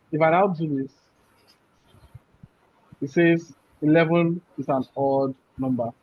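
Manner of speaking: ordinary talk, nothing marked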